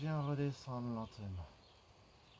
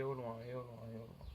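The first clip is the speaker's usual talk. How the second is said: soft repeats sinking lower and fading away; fading soft cue; 4 semitones lower, 9 dB quieter, faster than usual. Shown as a demonstration. Coach guiding a long stretch, soft and fading. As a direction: let each repeat sink quieter with the exhale.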